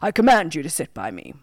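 kingly order, lightly voiced; insistent king. A narrator's reading. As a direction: insist with a monarch's authority. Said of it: "insisted and said".

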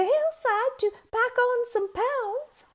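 tiny goat's high squeak; squeaky little voice. A narrator's reading. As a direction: squeak it like a very small billy goat. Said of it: "using his squeaky little voice".

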